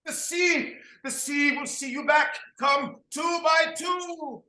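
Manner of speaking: high calling voice, slowing to a shout; high ritual call. Acted. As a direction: chant it out to the sheep. Calls the herd two by two.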